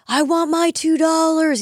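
high, slow, insistent demand; paper boy quote. Retold or imitated. Skier chased by a paper boy, high slow demanding whine. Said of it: "he's like".

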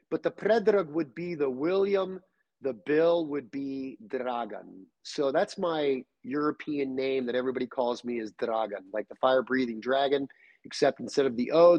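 usual speaking voice

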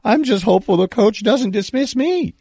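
high-pitched put-on voice of a university president; Gordon Gee impersonation. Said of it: "I'll try to do a Gordon Gee impersonation".

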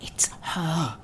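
tense whisper. Acted. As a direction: whisper it, alarmed. Captor at the door.

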